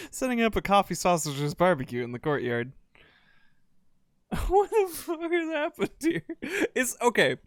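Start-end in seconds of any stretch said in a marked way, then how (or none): 4.3-7.4 laughing disbelief. Real, not acted. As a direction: laugh through the words in disbelief. Laughing disbelief at strikers scene.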